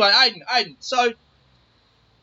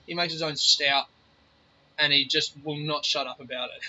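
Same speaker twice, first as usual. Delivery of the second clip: usual speaking voice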